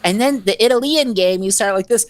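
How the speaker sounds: put-on high little-kid voice earnestly explaining chess; little daughter explaining. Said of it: "she's like".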